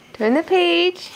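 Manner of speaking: usual speaking voice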